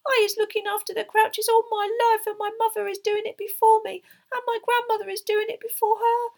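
very high, loud elf lament; high-pitched grieving house-elf; tearful wail. A narrator's reading. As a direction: wail in despair as a tiny elf. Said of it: "tears leaking down her face".

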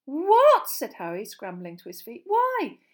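shocked protest. A narrator's reading.

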